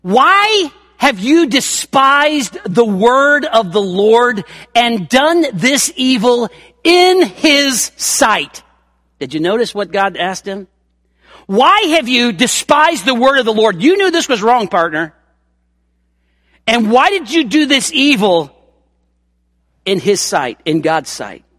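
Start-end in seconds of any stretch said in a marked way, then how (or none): 0.0-8.6 loud, slow prophetic rebuke; stern rebuke. Acted. Preacher as Nathan rebuking King David, loud and slow. 11.5-21.5 loud accusing question, then easing off; stern rebuke. Acted. Preacher repeating God's question to David, loud and stern.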